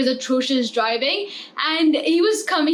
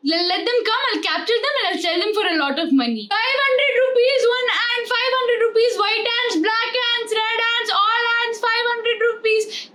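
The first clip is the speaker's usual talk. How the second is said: mock Indian seller hawking ants, higher and rising; playful Indian accent, pitched up on the prices; 5 semitones higher than usual. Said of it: "let's think about Indians".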